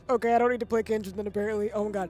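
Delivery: normal talking voice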